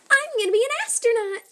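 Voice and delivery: high, fast and eager; bubbly excited kid; excited girl. Acted. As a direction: blurt it out thrilled. Excited child dreaming of space.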